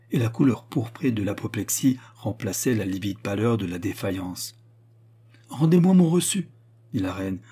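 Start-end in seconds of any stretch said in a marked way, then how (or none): 5.5-6.5 high, loud royal command; imperious order. A narrator's reading. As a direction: demand it back, voice raised. (ordre impérieux, voix haute et forte)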